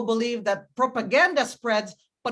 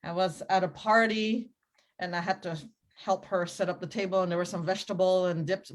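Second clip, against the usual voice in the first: ordinary delivery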